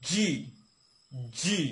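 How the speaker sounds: "জি" as a firm j off the upper palate; correct letter G. Shown as a demonstration. Teacher recalls Arabic reading lessons, "জি" pressed from the palate. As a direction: stick the tongue to the palate for "জি". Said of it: "এটা হবে".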